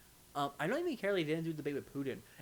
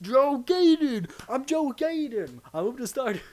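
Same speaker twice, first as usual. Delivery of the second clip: much higher, loud and slow goofy voice; silly announcer voice; 12 semitones higher, 12 dB louder, slower than usual. Pretend. Introducing special guest, loud high slow cartoon voice. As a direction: shout the name like a cartoon.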